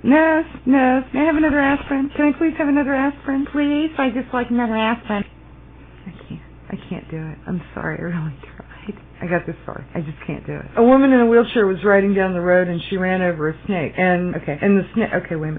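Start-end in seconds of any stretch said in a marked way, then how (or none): 0.0-5.2 high, plaintive patient voice; pleading patient. Acted. Patient begging the nurse for another aspirin, pleading. 6.1-10.7 hushed, nearly whispered apology; defeated. Pretend. Apologising for failing the accent, quietly defeated.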